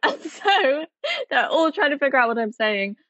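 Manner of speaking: ordinary talk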